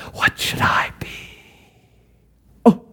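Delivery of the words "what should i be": softly whispered question. Acted. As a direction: breathe it out in secret. Caliph wonders in secret.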